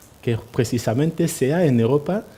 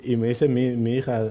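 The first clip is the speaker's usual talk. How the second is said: usual speaking voice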